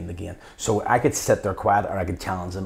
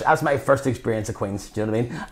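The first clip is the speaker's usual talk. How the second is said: ordinary delivery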